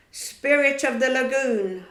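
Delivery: loud summoning call. A narrator's reading. Hook calls loudly to the spirit of the lagoon. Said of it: "Hook called out".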